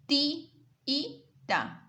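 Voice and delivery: Ti-i-ta with each i said apart, slowly; syllable split. Shown as a demonstration. Teacher spelling out the hiatus in tiíta. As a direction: pull the two i's apart as ti-i-ta. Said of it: "Le colocan aquí la tilde".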